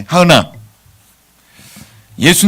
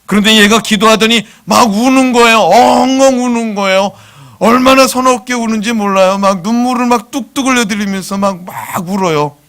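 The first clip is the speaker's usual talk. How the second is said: much higher and louder, emotional preaching; fervent sermon climax; impassioned; 8 semitones higher, 7 dB louder than usual. Acted. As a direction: let the story swell with feeling. Preacher retelling child sobbing.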